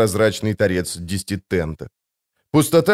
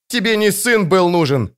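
high-pitched, moderately raised cry; contained narrator's shout, bitter; shouted accusation; 8 semitones higher than usual. A narrator's reading. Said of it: "закричал он".

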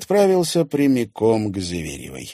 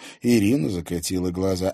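ordinary delivery

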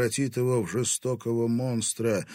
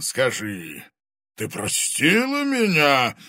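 loud, slow, high booming dragon; menacing fire dragon voice; booming arrogant dragon; 6 semitones higher, 8 dB louder, slower than usual. Acted. Dragon asks.

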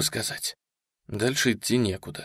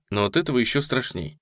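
ordinary delivery

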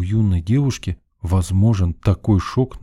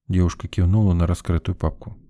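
ordinary delivery

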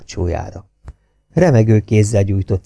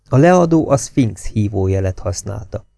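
ordinary delivery

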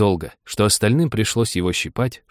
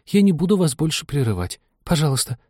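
high, contrite little plea; very humble; 7 semitones higher than usual. A narrator's reading. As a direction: promise meekly, pleading high. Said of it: "очень смиренно".

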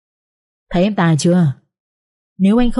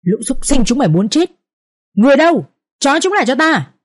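high, sharp commanding shout; a young master yelling for his servants; 5 semitones higher than usual. A narrator's reading. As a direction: bark the order to seize them. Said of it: "tiếng quát đanh gọn".

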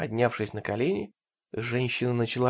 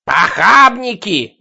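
frenzied scream; 9 semitones higher, 12 dB louder, much slower than usual. Acted. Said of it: "не унималась женщина".

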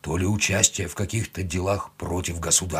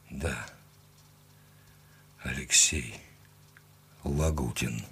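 low, very soft, halting words; wounded man barely able to speak; forced out weakly; 7 semitones lower, 8 dB quieter, slower than usual. A narrator's reading. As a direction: squeeze each word out with long pauses. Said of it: "выдавил он".

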